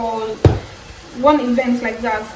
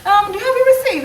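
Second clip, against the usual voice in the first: much higher, gushing shop assistant; sweet shop assistant; 12 semitones higher than usual. Retold or imitated. Cashier reassuring a returning customer, much higher and gushing. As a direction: coo it like a too-sweet cashier.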